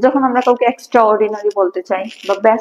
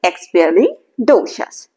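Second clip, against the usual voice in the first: slow chunked syllables, each chunk said on its own; teacher drilling the word part by part; slow part-by-part pronunciation drill; repeat after me drill; much slower than usual. Shown as a demonstration. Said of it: "So, repeat after me".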